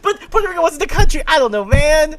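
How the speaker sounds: high, loud laughing protest; squealing with laughter. Real, not acted. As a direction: defend yourself through giggles. Laughing defence after being called out.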